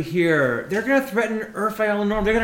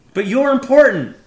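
bitter outburst; 6 semitones higher, 7 dB louder than usual. Acted. Bitter speech to Norman.

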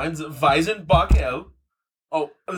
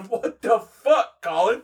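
mock outrage; 7 semitones higher, 4 dB louder, much slower than usual. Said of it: "And I said".

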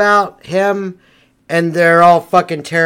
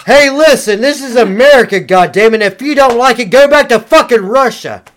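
louder, higher flag-waving American bluster; mock patriot rant; 4 semitones higher, 4 dB louder than usual. Mock patriot yells at the imagined Russian to go home. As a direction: bark it like an angry American patriot.

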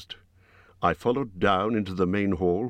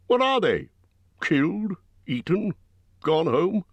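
blunt Bjorn, firing questions; 11 semitones higher than usual. A narrator's reading. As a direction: voice Bjorn's impatient quiz. Bjorn voiced by narrator.

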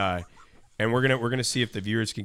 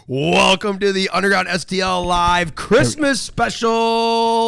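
announcer voice; 7 semitones higher, 5 dB louder, slower than usual. Acted. Show host announcer intro.

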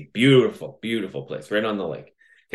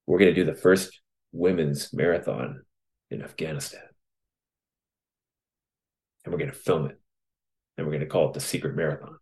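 low, soft, with long pauses between phrases; hushed resolve; 5 semitones lower, 5 dB quieter than usual. Retold or imitated. Runner tells his wife his marathon plan, in hushed resolve. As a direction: quote his vow quietly and deliberately, letting each step sink in.

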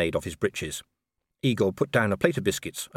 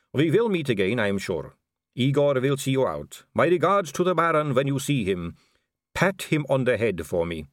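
higher-pitched, formal aristocratic farewell; smooth vampire lady; 7 semitones higher, 4 dB louder than usual. Acted. Vampire politely dismissing Sir Samuel, poised. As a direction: close the meeting with polite poise.

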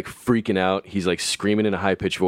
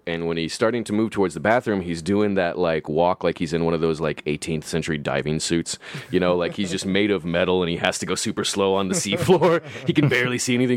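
usual speaking voice

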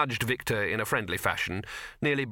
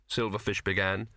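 plain reading voice

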